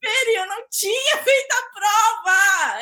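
high, drawn-out mock wail; retold panic. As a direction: wail it in exaggerated exam panic. Retells panic over an unfinished exam.

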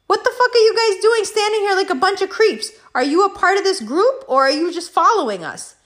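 high-pitched indignant challenge; retold confrontation. Friend confronting men who followed the girls, indignant challenge.

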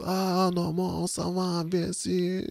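high-pitched mock French chatter; gespieltes Französisch. Imitating French relatives' fast gibberish. (nachgeäfftes Französisch)

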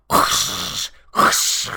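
breathy imitated rush of air; storyteller mimicking the creature's rush, airy whoosh; whooshing sound effect. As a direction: make the whoosh of something sweeping in.

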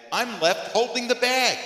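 high, loud indignant protest; mock outrage. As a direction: protest loudly as the one left out. Mock outrage at senseless joke.

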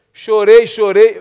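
ordinary talk

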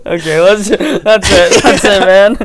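talking through laughter; can't stop laughing while speaking. Real, not acted. Breaks up laughing.

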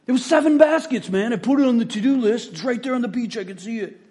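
lightly voiced dude insisting he's not to blame; defensive bro. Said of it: "You know what these bros do?".

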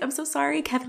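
small, high, soft apology; apologetic. Retold or imitated. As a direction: imitate a sheepish plea, high and quiet. Apologizing to nurse, retold.

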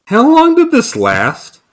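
high incredulous outrage. Real, not acted. Mocking the cheap costumes.

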